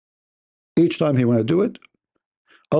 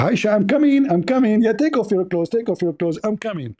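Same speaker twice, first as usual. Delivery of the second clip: calling out; 8 semitones higher than usual. Acted. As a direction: call out eagerly to her. Caller calling to wife.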